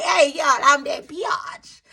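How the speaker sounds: slow, drawn-out, sassy party entrance; confident strut. Retold or imitated. Wife arriving at the party, slow and full of sass. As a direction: own the room as you walk in. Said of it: "showed up to the party like".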